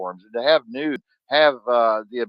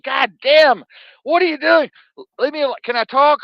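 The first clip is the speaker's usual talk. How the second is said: high and loud, fed up at being interrupted; exasperated outburst; 8 dB louder than usual. Retold or imitated. Himself to Bruno, trying to get a word in, loud and high. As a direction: throw your hands up, pitch up and push louder. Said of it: "I'm like".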